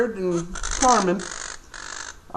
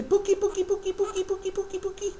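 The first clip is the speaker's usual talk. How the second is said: much higher, sing-song Pookie Pookie for the bird to copy; high bird-teaching voice; 12 semitones higher, 5 dB quieter, faster than usual. Shown as a demonstration. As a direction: coax the parrot to repeat it, way up high.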